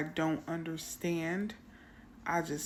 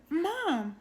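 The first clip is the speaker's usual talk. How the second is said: put-on much higher plaintive child's Mom; teary child call; 11 semitones higher, 3 dB louder than usual. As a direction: call Mom close to tears. Daughter calling out, near tears.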